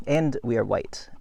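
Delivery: ordinary delivery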